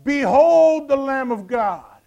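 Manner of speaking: loud, high, drawn-out proclamation; unashamed excited cry. Acted. John the Baptist announcing Jesus to his disciples, excited shout. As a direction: shout it slowly, bursting to announce him. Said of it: "John wasn't being shy right here".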